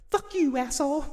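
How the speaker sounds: high-pitched falsetto retort; hammed-up squeaky comeback in a female role; mock angry woman. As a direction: jump way up into a mock-furious falsetto. Acting Maggie answering Negan.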